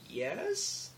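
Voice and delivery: high questioning yes; a sheepish, rising yes; mock unsure yes. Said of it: "I was like".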